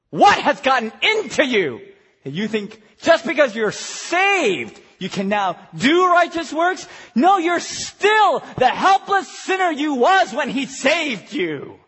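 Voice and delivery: loud, emphatic rebuke from the pulpit; forceful preaching. Real, not acted. Preacher rebuking believers trusting their works, forceful. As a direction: drive each point home hard.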